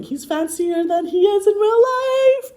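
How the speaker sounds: very high, loud, drawn-out singsong; exaggerated high-pitched ribbing; mock teasing. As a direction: tease in a sustained high wail. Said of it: "more of an Edinburgh accent".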